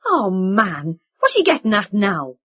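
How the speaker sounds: forced loud outburst; blustering too loudly to hide unease; too loud cry. Acted. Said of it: "cried loudly, too loudly".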